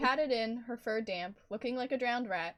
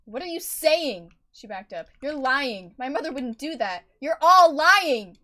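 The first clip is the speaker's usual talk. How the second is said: loud, higher, furious denial; angry shouting disbelief; barked; 5 semitones higher, 15 dB louder than usual. Acted. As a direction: yell at them, slow on the last line. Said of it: "she barked out".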